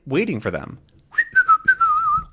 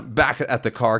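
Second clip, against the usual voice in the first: ordinary talk, nothing marked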